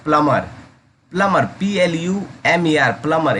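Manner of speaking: "प्लमर" with no b sound, plum-er; teacher modelling "प्लमर" without the b; silent b plumber. Shown as a demonstration. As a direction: say "प्लमर" dropping the b entirely. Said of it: "इसे हम लोग प्लमर".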